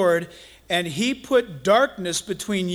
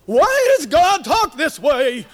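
slow, raised, booming preacher parody; mock televangelist; 6 semitones higher, 6 dB louder, slower than usual. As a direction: stretch every word in a hammed-up pulpit voice. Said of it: "just like the guys on Channel eleven".

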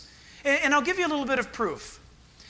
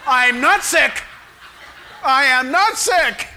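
louder, laughing declaration; defiant confession; 2 semitones higher, 5 dB louder, slower than usual. Retold or imitated. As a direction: insist on it like a faith proclamation. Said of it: "word faith movement guy".

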